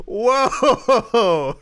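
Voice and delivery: loud startled exclamation; whoa. Real, not acted. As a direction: jump at the ad. Reacting to weight-loss ad.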